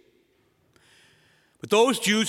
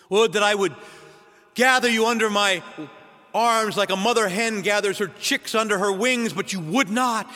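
lament; 5 semitones higher than usual. Retold or imitated. Preacher voices Jesus' lament.

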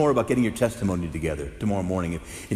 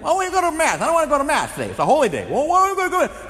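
put-on high, loud whining child; high-pitched child complaining about Mass; whiny kid; 7 dB louder, faster than usual. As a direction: whine like a kid dragged to church.

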